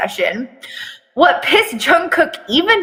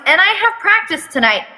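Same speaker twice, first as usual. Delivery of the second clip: whining; 8 semitones higher, faster than usual. Acted. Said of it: "he whines".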